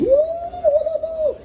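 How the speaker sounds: high thin crowd whooping; cheering audience. Retold or imitated. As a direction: imitate a crowd's woos in falsetto. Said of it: "the audience makes noise".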